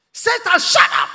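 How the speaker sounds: explosive high-pitched shouted rebuke; full-force, piercing retold shout; sudden shout. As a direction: yell it suddenly at the devil. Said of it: "he shouted".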